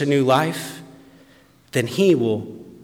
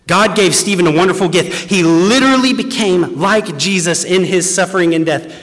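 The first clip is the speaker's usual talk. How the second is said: rising, louder preacher's cry; preaching at full force; 8 semitones higher, 7 dB louder than usual. Acted. Preacher to his congregation, preaching at full force. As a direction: build the sermon to a ringing call.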